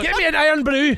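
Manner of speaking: loud, high comic Scottish; hammed-up Scottish shout; Scottish accent. Friend playing the woman for laughs, loud Scottish accent. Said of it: "I'll do a very good Scottish accent".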